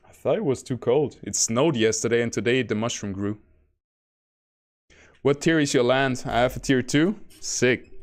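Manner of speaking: ordinary delivery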